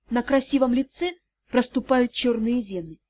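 usual speaking voice

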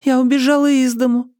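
put-on high-pitched young girl; small, high daughter's voice; girl's voice. A narrator's reading. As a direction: confess it in a high girlish voice. Girl confesses to her mother.

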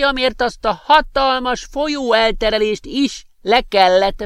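magas, lassú öreges hang; vidám, kissé kapatos öreg professzorként; öreg professzor. Acted. Professor's voice continues. (magas, lassú professzorhang)

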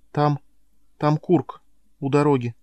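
usual speaking voice

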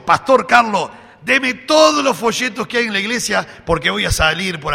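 high, loud, eager request to the pastor; mock-eager churchgoer pleading for leaflets; eager believer. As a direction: burst in full of zeal.

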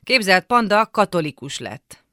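calling out big news to someone in the next room; shouting in. A narrator's reading. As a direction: shout the news through a closed door. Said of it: "és bekiabáltam".

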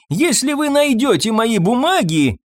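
high, far-carrying shout; calling after them. A narrator's reading. Doctor shouts after the couple climbing stairs, calling out. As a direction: shout it after people leaving. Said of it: "крикнул им вслед доктор".

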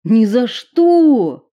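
loud desperate yell; shouted refusal. Acted. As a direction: cry out no. Will refuses.

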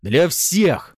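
high, loud retort; shouted. A narrator's reading. Husband answering his wife's challenge, shouted. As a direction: yell it back at her. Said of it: "крикнул он".

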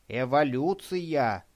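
slow, each syllable clipped out; boy pronouncing a weighty one-word verdict; clipped, meaningful. A narrator's reading. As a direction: spell out Эволюция slowly with weight. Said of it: "со значением отчеканил Радик".

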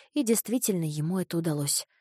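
ordinary delivery, nothing marked

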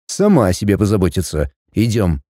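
low, clipped man's reply; curt male voice. Acted. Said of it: "бросив короткое".

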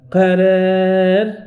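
قرار drawn out slowly, long a bent toward e; imala on قرار. Shown as a demonstration. Teacher models imala on قرار for students. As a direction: stretch قرار with its alif tilted toward e. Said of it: "فنقول".

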